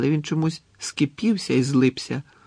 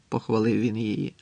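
plain reading voice

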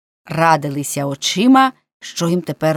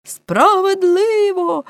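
high, drawn-out cry of approval; crowd's shout; 13 semitones higher, 3 dB louder, much slower than usual. A narrator's reading. Men backing their chief, high shouted agreement. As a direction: call it out long and high. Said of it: "гукнули всі".

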